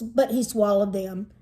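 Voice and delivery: ordinary delivery, nothing marked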